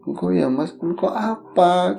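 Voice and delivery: soft, slow, mournful plea; tearful ghost mother. Retold or imitated. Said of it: "Tapi ngomongnya sambil nangis, sedih". (ibu hantu bicara pelan sambil menangis sedih)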